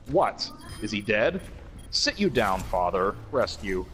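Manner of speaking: slow, solemn recital of the lyrics; dramatic lyric reading. Acted. Reading Walrus lyrics aloud to co-hosts, slow and mock-solemn. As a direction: read it like grave poetry, taking your time.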